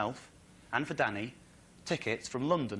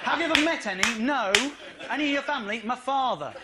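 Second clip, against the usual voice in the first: high, louder incredulous re-enactment; exasperated replay; 9 semitones higher, 5 dB louder than usual. Retold or imitated. Comic recapping his interview to audience, exasperated. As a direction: replay the exchange in rising disbelief.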